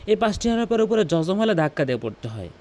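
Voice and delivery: ordinary talk, nothing marked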